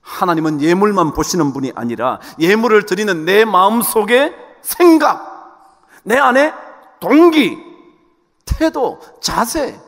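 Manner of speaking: building to loud, high single-word punches; emphatic sermon climax; forceful preaching. Acted. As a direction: hammer out each word to the congregation.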